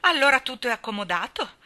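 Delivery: high, loud, laughing delight; jubilant cry. A narrator's reading. The rosy lady, relieved the parrot is dead, cries out jubilantly. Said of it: "gridò con aria di giubilo".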